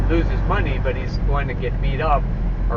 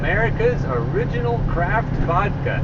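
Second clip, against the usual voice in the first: mock ad read; 6 semitones higher than usual. Mock vodka commercial read.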